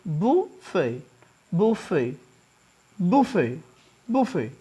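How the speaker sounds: buffet as boofay, t silent; buffet said boo-fay; correct pronunciation. Shown as a demonstration. Teacher repeating buffet correctly. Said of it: "that is buffet".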